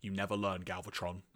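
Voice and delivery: low, soft aside; muttering to himself. Acted. Sound Blaster to himself as Galvatron runs off, muttered. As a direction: say it under the breath. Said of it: "Sound Blaster to himself just says".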